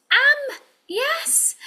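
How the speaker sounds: high, bright reveal of the word; excited reveal. Acted. Teacher revealing the blended word to the class, delighted. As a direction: burst out with the answer.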